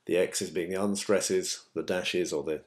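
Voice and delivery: ordinary talk, nothing marked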